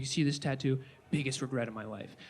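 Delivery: put-on low, quiet, solemn old man; grave old man. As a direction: sombre regret, lightly. Grandfather voiced, low and soft.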